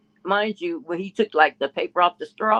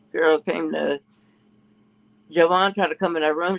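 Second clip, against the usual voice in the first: ordinary delivery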